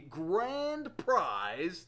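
drawn-out, very slow prize reveal; hyped-up announcement. Pretend. Host announcing the giveaway prize, hammed-up hype. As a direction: stretch the words out to hype it. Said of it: "Did I hype that enough?".

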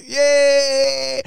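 high, loud little yay; excited cheer. Real, not acted. Host cheering holiday wishes to listeners, excited high yay. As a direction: burst into a happy cheer.

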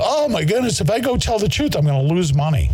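high mocking whine; high-pitched mock of a worried sellout; mocking quote. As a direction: mimic a timid sellout in a high fretful voice. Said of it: "Are you kidding me?".

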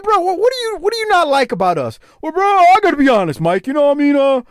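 high, flustered put-on voice; mock-earnest high-voiced hedging; mocking guest impression. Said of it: "And then I, I said".

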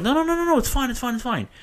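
squeaky-high, rapid-fire refusal; high-pitched protest. Retold or imitated. Declining a friend's offer to pay, high-pitched protest.

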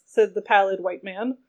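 ordinary talk

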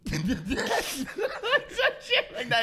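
laughing imitation. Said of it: "he goes".

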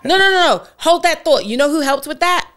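loud, high, eager cut-in; excited. Real, not acted. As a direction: jump in excitedly to give credit. Excited interruption to credit Bugsy.

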